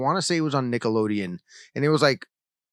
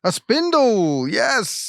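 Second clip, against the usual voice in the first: high, slow, drawn-out victory cry; mock triumphant; 10 semitones higher, 5 dB louder, much slower than usual. Co-host finally naming the spindle, hammed-up triumph. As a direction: announce it like you won the quiz.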